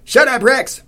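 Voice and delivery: high, loud command to a dog; stout lady's yell. A narrator's reading. Stout lady to her barking dog, yelling. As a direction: holler at your barking dog. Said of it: "said the stout lady".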